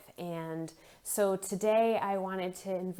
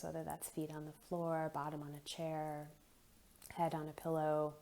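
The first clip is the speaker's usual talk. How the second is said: soft, unhurried meditation voice with long pauses; guided meditation; 6 dB quieter than usual. Acted. Leads a guided breathing meditation.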